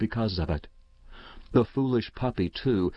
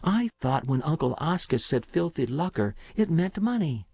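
a hint of a child, pitched up; lightly voiced puzzled boy; 6 semitones higher than usual. A narrator's reading. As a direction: explain like a slightly confused boy. Boy explains his confusion.

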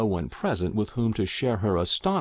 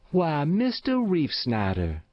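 raised, bright-pitched stout farm wife; surprised old Matilda; 7 semitones higher than usual. A narrator's reading. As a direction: greet him with a surprised cry. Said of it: "exclaimed old Matilda herself".

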